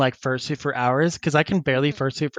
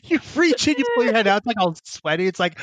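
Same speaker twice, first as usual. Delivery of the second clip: usual speaking voice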